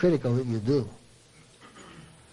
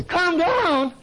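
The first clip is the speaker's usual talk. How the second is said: a much higher, outraged "Calm down?"; indignant echo; 12 semitones higher than usual. Retold or imitated. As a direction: throw the advice back in disbelief, pitched way up. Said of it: "And they say".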